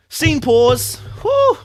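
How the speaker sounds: high, loud whoop; excited exclaim. Real, not acted. Reader breaking off after a juicy scene, excited. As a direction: blow off steam after the scene.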